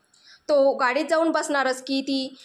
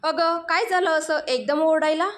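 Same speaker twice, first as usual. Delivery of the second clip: ordinary delivery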